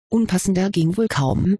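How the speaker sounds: ordinary delivery, nothing marked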